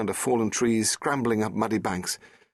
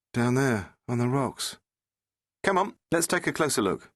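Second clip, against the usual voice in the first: lightly higher character voice; voiced characters; 6 semitones higher than usual. Rose and the Doctor on the cliff, lightly voiced. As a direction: lift the voice for the dialogue.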